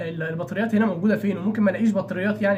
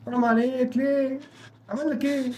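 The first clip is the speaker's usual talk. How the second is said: higher-pitched plea; pleading protest; 5 semitones higher than usual. Real, not acted. Player protesting to an attacker in the game, pleading higher.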